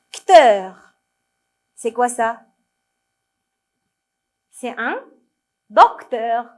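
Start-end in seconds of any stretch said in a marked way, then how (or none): 0.1-0.9 "cteur" read loudly as a bare fragment, first syllable missing; incomplete word read out. Shown as a demonstration. Teacher reading the gapped word to children, "cteur" loud and stretched. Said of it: "essayez de lire ce qui est écrit". 5.7-6.5 "docteur" loud, in two distinct syllables, doc and teur; completed word sounded out. Shown as a demonstration. Teacher giving children the full word, "docteur" loud and carefully articulated. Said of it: "la syllabe qui manque ici".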